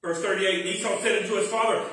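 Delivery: ordinary talk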